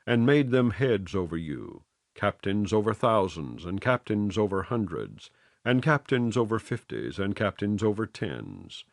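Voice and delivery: ordinary delivery, nothing marked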